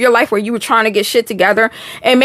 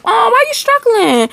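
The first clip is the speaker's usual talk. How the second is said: mock concern; 11 semitones higher than usual. Playful reply to a viewer.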